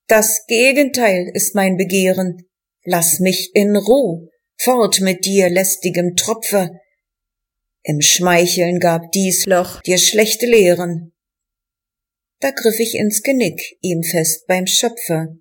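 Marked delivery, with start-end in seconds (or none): none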